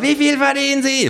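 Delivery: very high pollster cry; high-pitched survey hunter; hounding sociologist. Acted. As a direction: badger them in a high put-on voice. Sociologists joining the chase.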